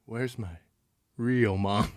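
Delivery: low, very soft, halting question; creepy quiet. Retold or imitated. Sleepwalker just back from hugging mom, creepy quiet. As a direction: say it softly with an eerie pause before the end.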